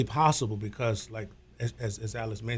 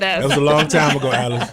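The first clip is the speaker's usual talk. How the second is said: laughing protest; 2 semitones higher, 7 dB louder than usual. Real, not acted. Embarrassed denial, laughing.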